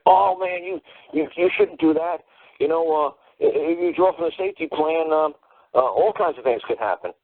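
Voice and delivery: high-pitched, alarmed lawyer voice, hammed up; mock a nervous attorney warning his client; imitated attorney. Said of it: "And he's gonna say".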